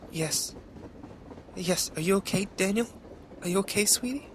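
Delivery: high, trembling comfort; shaken tenderness. A narrator's reading. Neighbour comforts the injured boy, high and shaken. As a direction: soothe him while still frightened.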